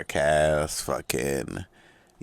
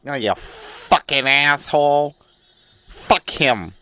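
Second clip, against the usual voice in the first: high, slow sneering troll voice; mocking hater voice; 10 semitones higher, 5 dB louder, slower than usual. Comedian voicing an imagined hater trashing him, high sneer. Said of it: "somebody with that voice".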